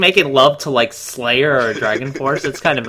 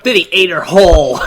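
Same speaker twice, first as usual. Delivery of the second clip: gleeful punchline; 7 semitones higher, 8 dB louder than usual. Real, not acted. Gleeful joke about boa constrictor.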